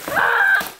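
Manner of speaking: high, loud imitated scream; startled scream. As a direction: shriek like a scared friend. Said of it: "And he's like, and he's like".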